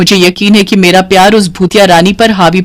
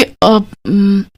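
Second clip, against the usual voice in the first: hesitant; 6 dB quieter, much slower than usual. Acted. Hesitating before a confession.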